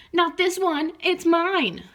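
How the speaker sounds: stubborn frog claim; defiant croak. Acted. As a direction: refuse him defiantly. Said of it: "croaked defiantly".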